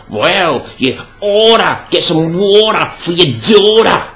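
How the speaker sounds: put-on American accent, drawn out "water" and "daughter"; mock American accent. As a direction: put on an American twang for the rhyme.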